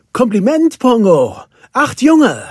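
high, loud, proud praise; delighted congratulation. A narrator's reading. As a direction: congratulate him with real excitement. Roger congratulates the new father. (begeistert gratulierend)

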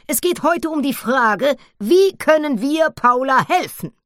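put-on high, loud old granny voice; a bossy grandmother chairing the meeting; jungle granny. (Urwaldoma, hoch und laut)

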